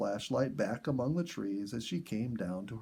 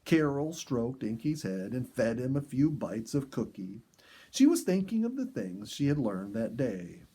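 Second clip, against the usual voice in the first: usual speaking voice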